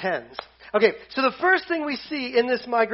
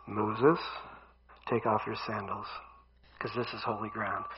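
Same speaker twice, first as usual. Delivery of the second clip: much lower, soft and solemn voice of God; 11 semitones lower, 8 dB quieter than usual. Acted. God tells Moses from the bush to remove sandals, low and solemn.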